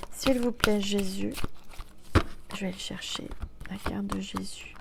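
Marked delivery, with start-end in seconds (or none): 2.5-4.7 soft whisper; hushed aside. Real, not acted. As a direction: mutter while searching the deck. Whispered while searching.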